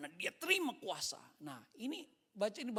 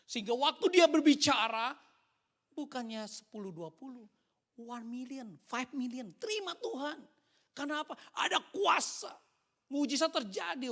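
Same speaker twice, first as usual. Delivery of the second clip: raised, high-pitched pulpit delivery; fervent preaching; 7 semitones higher, 12 dB louder than usual. Real, not acted. Preacher proclaims the evangelist's miracles to church, fervent and loud. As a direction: proclaim it loudly, building on key phrases.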